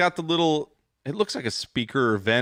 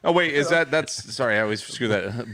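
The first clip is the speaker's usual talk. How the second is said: usual speaking voice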